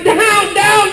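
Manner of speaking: high-pitched yelled command; mock yell. Caregiver ordering the dogs down, mock yell. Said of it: "Here I am yelling".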